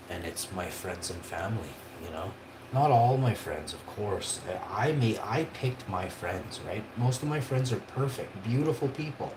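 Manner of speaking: ordinary talk, nothing marked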